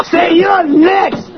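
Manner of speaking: high, hammed-up stand-up shouting; Chris Rock. Host confessing in the comic's style, Chris Rock impression. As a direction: rant in a comedian's high holler. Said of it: "That's Chris Rock".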